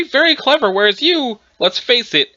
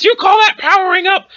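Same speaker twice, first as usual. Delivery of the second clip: higher, raised-voice challenge; insistent taunt; 7 semitones higher, 3 dB louder than usual. Acted. Challenging Gramps who ignored the question, insistent high taunt. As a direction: throw the question harder, pitched up.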